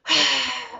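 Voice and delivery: big forceful sigh out; loud exhale sigh. Shown as a demonstration. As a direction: blow it all out. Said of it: "sigh it out".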